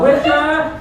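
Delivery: ordinary talk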